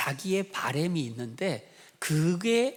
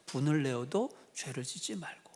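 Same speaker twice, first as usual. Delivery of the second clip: softer whispered scripture line; whispered verse; 4 semitones lower, 6 dB quieter than usual. Real, not acted. Preacher closing on scripture, whispered. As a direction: breathe the verse out gently.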